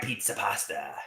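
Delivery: a soft trailing repeat; quiet. Acted. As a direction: fade the name out softly. Repeat, fading.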